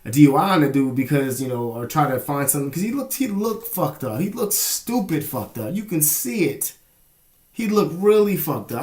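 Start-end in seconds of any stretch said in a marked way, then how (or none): 2.7-8.8 high-pitched incredulous telling; amused disbelief. Real, not acted. Telling viewers how wasted his friend looked, incredulous.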